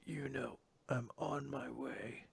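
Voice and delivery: very soft, slow delivery; hushed resolve. Acted. Battered Asta closing the chapter, hushed resolve. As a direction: murmur it slowly and steadily. Hero's quiet closing line.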